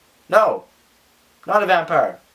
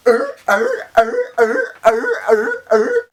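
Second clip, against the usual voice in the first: high, growly vampire "arr"s, rising each time; silly high-pitched vampire growls, louder than her chat; vampire impression; 4 dB louder, much slower than usual. Shown as a demonstration. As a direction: snarl like a hammed-up cartoon vampire. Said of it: "my impression of a vampire".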